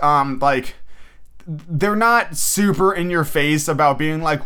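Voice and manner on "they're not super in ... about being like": usual speaking voice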